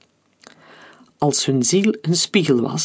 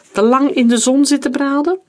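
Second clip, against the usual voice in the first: high, louder mocking question; dripping with malicious glee, pitched up; gloating; 10 semitones higher, 5 dB louder than usual. A narrator's reading. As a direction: taunt him with open schadenfreude. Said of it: "Het leedvermaak droop van zijn woorden af".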